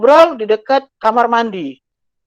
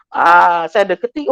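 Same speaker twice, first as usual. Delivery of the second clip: ordinary delivery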